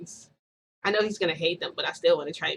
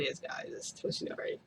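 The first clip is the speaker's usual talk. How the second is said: whispered reveal; 10 dB quieter than usual. Real, not acted. Teasing reveal to viewers.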